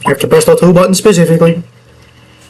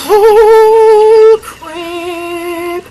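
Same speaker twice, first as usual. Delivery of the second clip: startled; 12 semitones higher than usual. Real, not acted. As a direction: caught off guard by a death. Game character dying.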